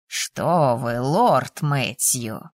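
low, slow, patronising lord; unhurried lordly condescension; condescending. A narrator's reading. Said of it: "снисходительно заметил".

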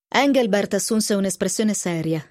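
usual speaking voice

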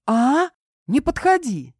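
high, startled warning; frightened. A narrator's reading. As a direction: keep him back in alarm. Frightened girl backs into wall.

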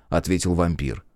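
plain reading voice

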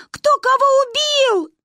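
high, loud, stretched-out question; child's bawling yell. A narrator's reading. Girl yells over fence about murder, slow high shriek. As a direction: scream the question in panic. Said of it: "орала я".

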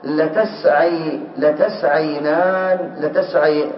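drawn-out syllable by syllable; very slow spelling-out. Shown as a demonstration. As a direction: stretch every syllable of لتسعي. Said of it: "هذا الآن الفعل". (تهجئة بطيئة جدًا)

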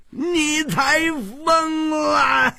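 high, slow, tearful shout; furious roar. Acted. The king hurls the words back at Hu Tiehua, roaring high and slow. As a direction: throw the insult right back. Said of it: "龟兹王怒吼道".

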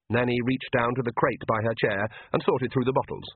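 usual speaking voice

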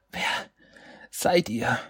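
put-on rough, croaking girl's voice; raspy croak. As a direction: croak it out, voice rasping. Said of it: "ein raues Krächzen".

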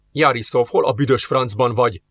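usual speaking voice

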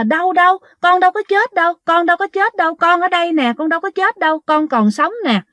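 high, hurried and scared, pleading; startled girl insisting to the voice she is alive; frantic denial. Retold or imitated. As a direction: blurt it out half asleep and frightened. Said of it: "lật đật chị nói rằng á là".

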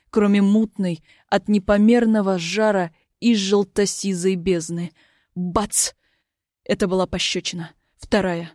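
usual speaking voice

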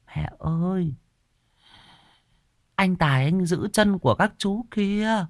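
put-on high childlike voice; young boy telling his mother about the ghosts; little boy. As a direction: speak as an innocent small boy pointing.

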